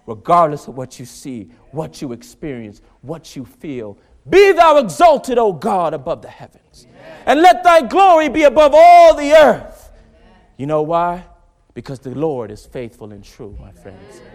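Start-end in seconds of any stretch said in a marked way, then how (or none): 4.3-9.9 very loud preaching, pressing hard; rising preaching shout. Real, not acted. Preacher warning the congregation, near shouting. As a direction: hammer it home to the church.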